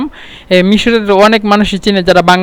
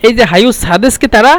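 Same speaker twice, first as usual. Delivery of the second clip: ordinary delivery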